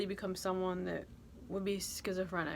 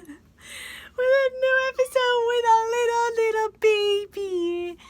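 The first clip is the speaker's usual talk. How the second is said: put-on baby talk; 10 dB louder, slower than usual.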